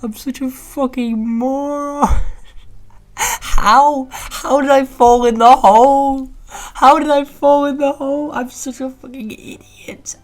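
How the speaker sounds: very high, loud, strangled wail of self-disgust; frustrated squeal. Real, not acted. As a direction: shriek at your own stupidity, stretched out. Fell into a hole, self-scolding.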